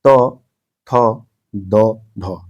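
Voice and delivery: त, थ, द, ध with the tongue on the upper teeth; dental sounds. Shown as a demonstration. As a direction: model त, थ, द, ध one by one for pupils to repeat. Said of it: "तो आप जरा बोलिए जरा".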